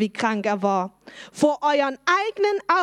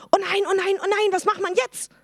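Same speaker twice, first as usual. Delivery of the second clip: higher, hammed-up worried priests; mock panic; 7 semitones higher than usual. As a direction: play the panicking Sadducees for kids. Sadducees panic at resurrection news.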